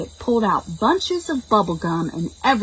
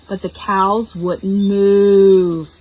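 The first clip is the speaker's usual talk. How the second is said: very slow, drawn-out and heavy; stubborn slow narration; slow stubborn refrain; 5 dB louder, much slower than usual. A narrator's reading. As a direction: drag it out like a cow that won't budge.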